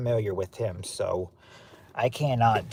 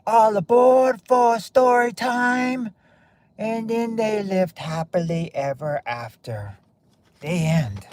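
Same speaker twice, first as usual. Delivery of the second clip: put-on rocker voice, drawn out and higher; big slow opening call, easing into a storybook close; 8 semitones higher, slower than usual. Streamer attempting a singer's accent for story time, celebrity impression. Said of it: "I don't know if I did a good [name] accent".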